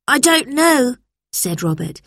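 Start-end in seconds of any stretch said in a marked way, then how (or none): 0.1-1.0 put-on high-pitched boy's admission; unknowing schoolboy. A narrator's reading. Robert fails the teacher's question, high boyish voice. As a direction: admit it in a raised child's voice. Boy admits ignorance to teacher.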